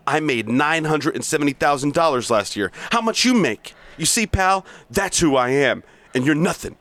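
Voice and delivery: hard-driving sales boss berating staff; bully the salesmen with contempt; reading Blake's tirade, pushy and mocking; aggressive sales boss. Plays Blake monologue.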